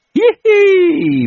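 squittio acutissimo e forte; risatina maliziosa. A narrator's reading. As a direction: ridacchia complice al curato. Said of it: "faceva l'assistente". (risatina acuta e maliziosa)

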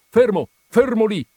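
high, loud warning cry; urgent, startled call; alarmed shout. A narrator's reading. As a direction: shout at him to stop right now. Stopping the thrashing man in alarm.

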